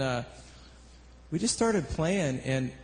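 ordinary delivery

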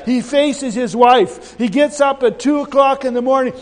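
ordinary talk, nothing marked

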